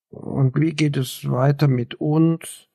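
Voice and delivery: slow, trembling, near-croaking question; hoarse young man anxiously asking about their future; tremulous voice. A narrator's reading. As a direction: let the voice quiver as he asks. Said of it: "fragte er mit tremulierender Stimme".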